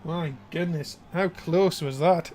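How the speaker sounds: ordinary talk, nothing marked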